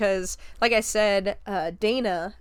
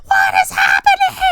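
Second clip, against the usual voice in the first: very high, loud mock shriek; hysterical screaming; 9 dB louder than usual. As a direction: imitate a woman screaming in utter bewilderment. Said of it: "she just starts screaming".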